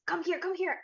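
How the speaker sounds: high-pitched hurried call; urgent beckoning. Acted. Man on the stairs calls the group over, urgent beckoning.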